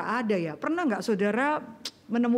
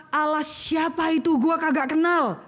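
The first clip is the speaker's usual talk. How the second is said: slangy defiant Pharaoh; 5 semitones higher than usual. Acted. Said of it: "Bahasanya sekarang ya".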